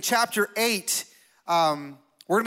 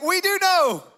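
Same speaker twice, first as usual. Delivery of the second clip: high, loud mock disbelief; hammed-up incredulous outburst; incredulous retort; 11 semitones higher, 5 dB louder, slower than usual. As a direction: exclaim it as a stunned comeback. Retold stunned retort to friends.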